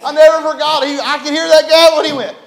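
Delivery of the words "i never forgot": ordinary talk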